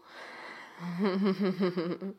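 a short dark chuckle. Acted. Teasing laugh mid-scene.

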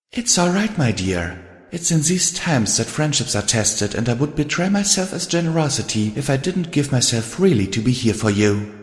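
caring friend Rarity. Acted. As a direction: coax a troubled friend to open up. Rarity reassures Twilight.

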